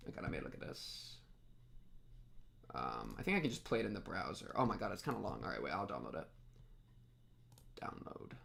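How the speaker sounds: hushed whisper to herself; quiet whispered muttering. Real, not acted. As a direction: say it under your breath. Whispering to self.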